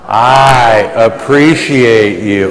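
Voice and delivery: slow, loud, drawn-out declaration; leading in unison. Real, not acted. Preacher leads the room saying it together, loud and drawn out. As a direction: belt it slowly so everyone joins.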